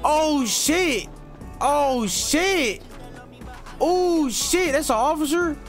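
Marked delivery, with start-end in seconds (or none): none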